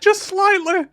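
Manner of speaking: high, loud slowed mock voice; squeaky mocking voice; mocking squeak. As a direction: squeak it out teasingly. Mocking repeat of the phrase.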